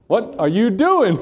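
high-pitched, slow mock scolding; hammed-up mom telling off. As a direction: imitate an exasperated mother, half laughing. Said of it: "my mom was saying".

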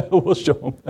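laughing through the punchline; amused repeat. Real, not acted. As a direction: chuckle as you repeat the joke. Laughing at own joke's punchline.

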